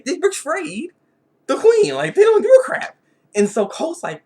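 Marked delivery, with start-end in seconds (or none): 0.1-3.0 much higher and louder, laughing in disbelief; laughing outrage. Real, not acted. Reviewer laughing at the guards abandoning the queen, high and loud. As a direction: crack up at how shameless they are.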